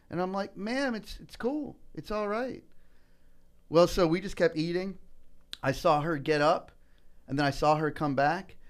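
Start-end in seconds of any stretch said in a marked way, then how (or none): 0.6-2.6 soft, higher-pitched, easy reassurance; softly acted soothing reply; gentle reassurance. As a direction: let an embarrassed stranger off the hook kindly. Retold reassurance to apologetic stranger.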